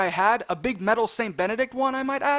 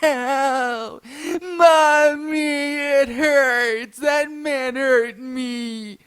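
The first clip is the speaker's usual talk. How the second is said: hammed-up childish wail; drawn-out mock sobbing, raised in pitch; screaming and crying; 4 semitones higher, 4 dB louder, much slower than usual. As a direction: bawl like a hurt child calling for mother. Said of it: "it starts screaming and crying like".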